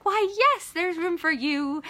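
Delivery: high, bright-pitched obliging witch voice; welcoming witch. Retold or imitated. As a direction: agree loudly and high, playacting the witch. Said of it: "she's like".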